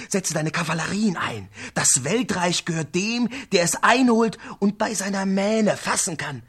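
high, pressing call to mount up; urgent warrior. Acted. A voice urging Brafane to the saddle, high and pressing. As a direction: urge him on, fast and loud.

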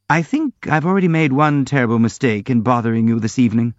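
usual speaking voice

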